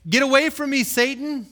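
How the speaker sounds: loud, high-pitched command; forceful rebuke. Acted. Jesus turning on Peter, loud forceful rebuke.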